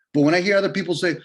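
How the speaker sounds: usual speaking voice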